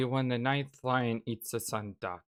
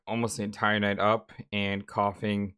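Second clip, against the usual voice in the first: ordinary delivery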